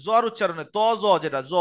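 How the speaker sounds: usual speaking voice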